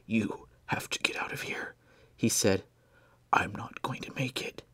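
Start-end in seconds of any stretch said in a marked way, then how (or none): 0.1-1.8 soft urgent whisper; dying whisper. Acted. Dying Blair to Benjamin, a weak urgent whisper. 3.3-4.6 soft urgent whisper; dying whisper. Acted. Dying Blair to Benjamin, a weak urgent whisper.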